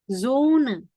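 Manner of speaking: "Zone" starting with a buzzing Z. Shown as a demonstration. Teacher giving S-before-vowel example, buzzed Z onset. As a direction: voice the first sound of "Zone". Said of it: "dia bacanya kayak Z".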